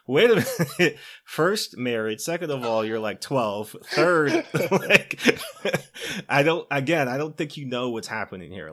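high, loud rebuff through laughter; laughing, flustered refusal. Retold or imitated. Gillian Anderson shuts down Nicholas, laughing loudly.

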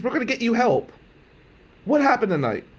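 high, insistent aunt voice; distraught aunt. Retold or imitated. Aunt questioning nephew about tonight, imitated anxious insistence. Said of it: "She goes".

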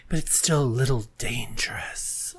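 whisper. Acted. Teasing about the word queer.

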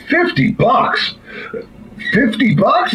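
high, drawn-out incredulous question; mock disbelief. Buyer stunned by the farmer's price for the talking dog, incredulous. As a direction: stretch it out high in comic astonishment.

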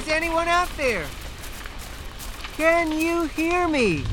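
high call, drawn out slow; calling from far away. Acted. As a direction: call out to the dark water. Calls over the wreck.

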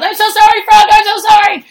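high, loud shout over the shoulder; yelled apology. Retold or imitated. Fleeing the dying frog, shouting back a high, loud apology. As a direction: yell it back while running away. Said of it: "over my shoulder I yelled".

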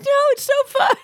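very high, gleeful squeal; high, delighted refusal; giddy squeal. Retold or imitated. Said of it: "We're like".

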